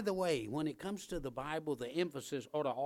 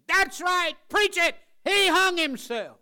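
high, loud mock congregation cheer; mock amen shout; 14 dB louder than usual. Congregation member shouting amen to the preacher, high and loud. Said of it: "we can all say".

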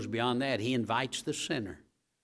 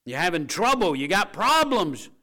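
preaching call; 6 semitones higher, 7 dB louder than usual. Acted. Preacher calls out to congregation.

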